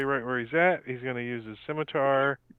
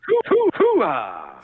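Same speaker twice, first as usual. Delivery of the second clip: slow, loud, building soldier chant; Al Pacino hoo-ah; 7 dB louder, much slower than usual. Acted. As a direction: stretch it out like a drill sergeant. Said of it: "Al Pacino".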